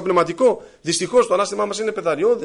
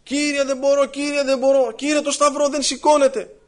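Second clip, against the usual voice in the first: very high-pitched repetitive moaning plea; grumbling wail; 10 semitones higher than usual. Acted. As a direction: whine to God that you cannot cope. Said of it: "πάλι να βαριγκωμεί".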